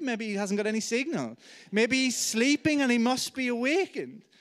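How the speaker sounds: high, loud sarcastic jeering; hammed-up mock concern for their god; mocking taunt. Elijah mocks Baal's silent prophets, high and sarcastic. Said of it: "he begins to taunt them".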